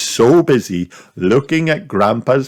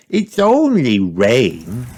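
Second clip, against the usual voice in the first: put-on comforting little girl; 4 semitones higher, slower than usual. A narrator's reading. Peppa comforting brother.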